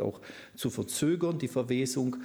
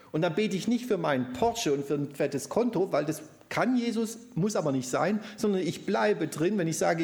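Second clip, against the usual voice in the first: fervent preaching; 7 semitones higher than usual. Real, not acted. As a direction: drive the point home with fervour. Preaching at full force.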